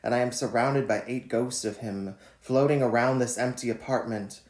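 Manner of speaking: measured, deliberate poem reading; poetry reading. Acted. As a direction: read each line like a spoken poem. Reads his poem Quarantine Lover.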